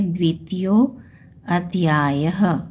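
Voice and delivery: द्वितीयो अध्यायः with अध्यायः drawn out slowly, final ह breathed; slow careful reading. Shown as a demonstration. Teacher showing students how to read the Sanskrit, slow. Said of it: "तो इसको हम कैसे पढ़ेंगे?".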